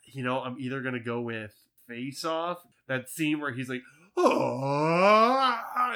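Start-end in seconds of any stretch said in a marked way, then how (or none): 4.2-5.9 high, loud mock scream; hammed-up Nick Cage scream; imitated Cage scream. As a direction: belt out a wild movie scream. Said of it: "screaming is awesome".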